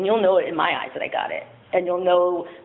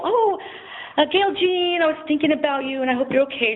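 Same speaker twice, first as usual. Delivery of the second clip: higher, lightly voiced caring church lady; sweet card voice; 8 semitones higher than usual. Reading a church friend's get-well card aloud, lightly voiced and high. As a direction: read the card up high like its kind writer.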